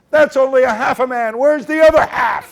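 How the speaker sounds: loud, high call into the chimney. Acted. Boy calling up the chimney, loud and fearless. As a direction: shout it up so it echoes.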